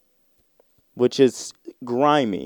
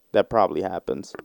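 usual speaking voice

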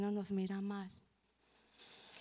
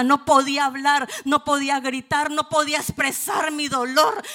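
loud, higher impassioned preaching; preaching at full force; 5 semitones higher, 10 dB louder than usual. Real, not acted. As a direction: cry it out to set the church free. Predica con fuerza creciente. (predicando a toda voz)

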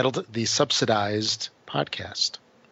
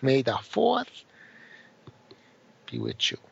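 Elmer Fudd voice; 3 dB quieter than usual. Retold or imitated. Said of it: "It's like Elmer Fudd".

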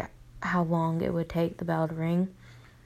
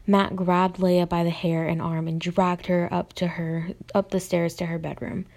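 ordinary delivery, nothing marked

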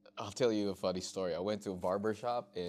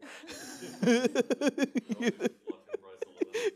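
big laugh; 4 dB louder than usual. Real, not acted. Laughs at own joke.

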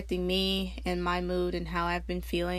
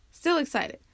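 still excited; 6 semitones higher than usual. Retold or imitated.

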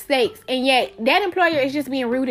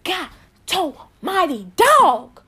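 exasperated plea; 7 semitones higher than usual. Acted. Person weighed down protesting to critics, exasperated. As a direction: plead your case, worn down and higher. Voicing hurt at being weighed down.

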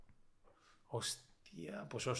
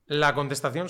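usual speaking voice

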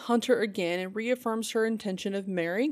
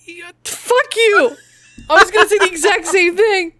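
high, loud laughing protest; mock-outraged through laughter; 10 semitones higher, 11 dB louder than usual. Real, not acted. Laughing banter.

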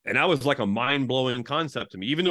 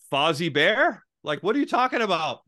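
retold puzzled question, raised in pitch and volume; baffled; 6 semitones higher, 4 dB louder than usual. Reacting to a mysterious acronym, baffled. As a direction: act out being thrown by a strange answer.

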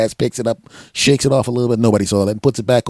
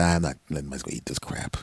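low, soft self-talk; muttered to himself; 7 semitones lower, 7 dB quieter than usual. Retold or imitated. At the grill, muttering to himself. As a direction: mutter it under your breath.

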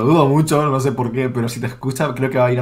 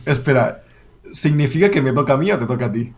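ordinary talk

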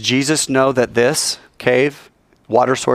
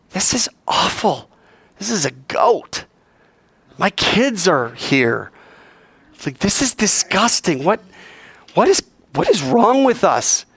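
dismayed onlooker; 9 semitones higher than usual. Retold or imitated.

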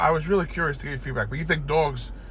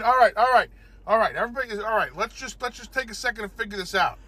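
ant leader; 7 semitones higher than usual. Acted. Ant leader calming the others.

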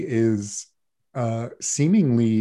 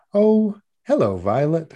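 high, startled awkward man; a lightly voiced startled eccentric; awkward greeting; 6 semitones higher, 5 dB louder than usual. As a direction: blurt out a surprised hello.

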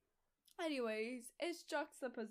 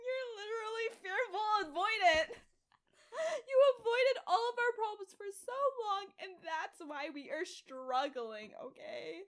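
hammed-up teary outburst; mock crying rant; 8 semitones higher, 6 dB louder than usual. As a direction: wail the accusation in mock tears. Mock divorce argument, heard crying.

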